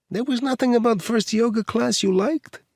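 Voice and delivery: high, playful Eastern European woman; teasing Eastern European accent. A narrator's reading. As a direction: tease him in a light Eastern European accent. Edita teasing.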